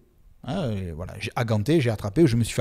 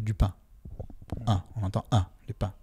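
standard Parisian accent; 4 semitones lower, 6 dB quieter than usual. Shown as a demonstration. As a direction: say "du pain" with a clean Parisian nasal, no tail. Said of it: "avec un accent plus standard ou parisien".

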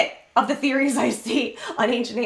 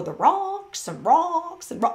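slow, higher imitation of a lecturing scholar; academic accent; 5 semitones higher, slower than usual. Fan imitates the TV historian with a slow, lightly mocking academic voice. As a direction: mimic a professor who has been in academia too long. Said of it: "he has this accent".

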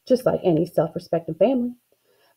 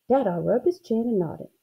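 usual speaking voice

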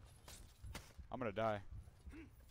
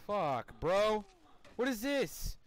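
loud high angry outburst; frustrated yell; 10 semitones higher, 9 dB louder, much slower than usual. Real, not acted. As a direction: yell in disbelief. Frustrated death.

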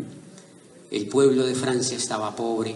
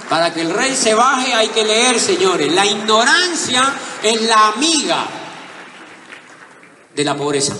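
loud, high shouted moral; rousing proclamation; 9 semitones higher, 8 dB louder than usual. Acted. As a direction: hammer the lesson home to the audience.

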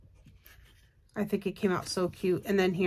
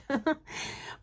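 high airy giggle; 7 semitones higher, 6 dB quieter than usual. Real, not acted. Laughs at her own road-trip dream.

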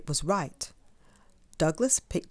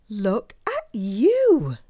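slow, loud, drawn-out fussing; dismayed mum; 4 semitones higher, 7 dB louder, much slower than usual. A narrator's reading. Mum seeing the state of Douglas, slow fussing. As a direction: stretch it out in motherly dismay.

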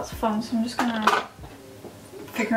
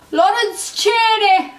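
drawn-out high, loud name reveal; excited announcement; 8 semitones higher, 9 dB louder, slower than usual. Real, not acted. Vlogger reveals one of her new favourite queens, excited announcement. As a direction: belt out the name, stretched and high.

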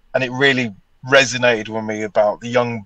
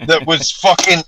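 mock rant; 5 semitones higher, 3 dB louder than usual. Ranting at bad spellers.

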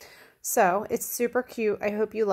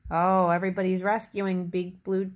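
usual speaking voice

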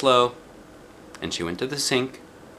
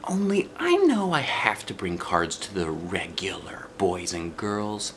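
hushed whisper; soft secret whisper; real soft; 4 dB quieter, slower than usual. A narrator's reading. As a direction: whisper it softly to the teacher. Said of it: "I said real soft".

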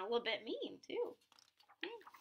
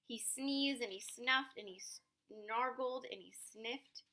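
usual speaking voice

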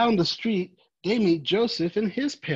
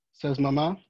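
ordinary delivery, nothing marked

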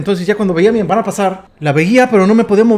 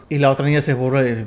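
ordinary talk, nothing marked

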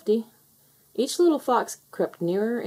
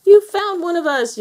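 high, loud excited yelp; 11 semitones higher, 8 dB louder than usual. A narrator's reading. Tufty to Mother Hen who found the missing fox, yelping. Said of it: "yelped Tufty".

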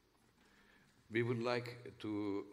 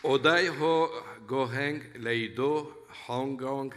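louder, deliberate phrases in newly learned Cantonese; careful learner's Cantonese; 2 semitones higher, 4 dB louder than usual. Real, not acted. Musician greeting the Hong Kong audience in Cantonese, loud and careful. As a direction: sound out each foreign phrase loud and carefully. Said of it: "please forgive me for my accent".

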